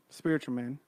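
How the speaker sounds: ordinary delivery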